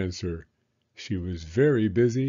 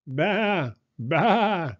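high bleating baa; sheep bleat; 6 semitones higher than usual. Acted. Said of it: "bleated the sheep".

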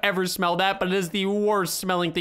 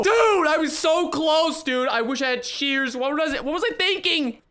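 exasperated yelling; 7 semitones higher than usual. Real, not acted. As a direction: wail at your own blunder. Just failed in the game.

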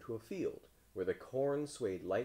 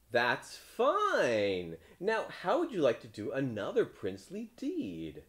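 raised, louder crowd voice; pleased townspeople; 7 semitones higher, 5 dB louder, slower than usual. A narrator's reading. Townspeople asking the frog another favour, high hearty voice.

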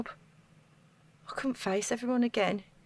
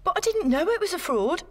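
high, loud burst of denial; panicked protest; 8 semitones higher, 6 dB louder than usual. Acted. Accused woman cries out her innocence to the detective. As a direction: blurt it out, defending yourself.

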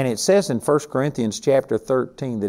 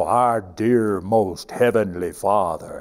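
lower, slow, put-on churchy prayer tone; imitating a showy holier-than-thou pray-er; mock pious prayer voice; 5 semitones lower, slower than usual. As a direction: mimic a stiff preacher's public prayer. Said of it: "all of a sudden they'd change. And they'd go".

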